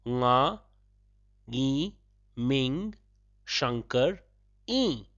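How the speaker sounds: "Nga, Ngi, Ming, Shankar, I" with a pause after each part; broken into syllables. Shown as a demonstration. Teacher breaking up a self-introduction for learners. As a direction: lay out each part separately. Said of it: "I shall break it up for your easy comprehension".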